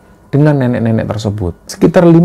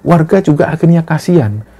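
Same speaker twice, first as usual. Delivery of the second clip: ordinary delivery, nothing marked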